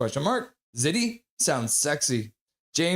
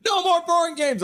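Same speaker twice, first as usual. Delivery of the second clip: much higher and louder, a squeaky mock read; mock chat voice; 5 dB louder than usual. Streamer reads Kayla's chat comment in a squeaky mock voice. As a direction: squeal the viewer's comment in a cartoonish voice.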